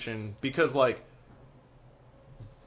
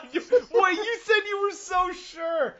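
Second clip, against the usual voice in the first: high, laughing disbelief; 5 dB louder than usual. Real, not acted. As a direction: laugh through the words. Laughing while talking.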